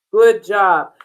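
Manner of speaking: ordinary talk, nothing marked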